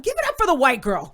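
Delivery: loud hype call; rally the crowd; emcee shout-out. Retold or imitated. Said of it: "he goes".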